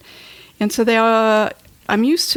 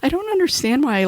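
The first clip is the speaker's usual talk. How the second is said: higher, quicker protest; mock-frustrated quote of oneself; retold exasperation; 8 semitones higher, faster than usual. Client retelling getting emotional in a conversation, mildly exasperated.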